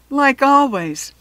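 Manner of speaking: slow, loud groan; moaning. A narrator's reading. Malcolm complaining to the lunch lady, moaning. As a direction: drag it out, fed up. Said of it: "Malcolm moaned".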